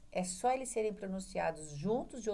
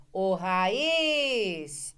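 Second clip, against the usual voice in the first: ô raiz! called out louder, landing on the final iz of raiz; 7 dB louder than usual. Shown as a demonstration. Teacher showing students how to test raiz, loud call. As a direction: call raiz like hailing someone, hitting the last syllable. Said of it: "Você faz assim".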